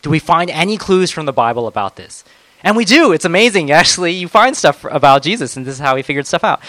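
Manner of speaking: ordinary talk, nothing marked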